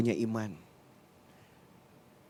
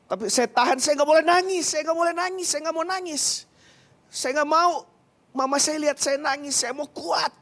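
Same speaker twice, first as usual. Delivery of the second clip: higher, louder, holding back tears; pleading with the congregation, on the edge of tears; fighting back tears; 7 semitones higher, 4 dB louder than usual. Real, not acted. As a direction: voice straining not to cry. Fighting tears recalling sick mother.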